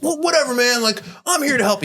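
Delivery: a light imitation of Greg getting defensive; upset Greg. Greg brushing off the bartender's complaint, high and miffed.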